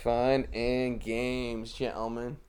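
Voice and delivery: very slow, soft drawled line; slow mock quote. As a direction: drawl it out lazily. Quoting viral line.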